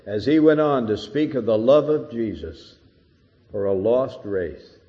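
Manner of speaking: usual speaking voice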